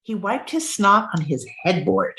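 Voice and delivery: animated bedtime story reading; reading to children. Acted. Reads picture book aloud.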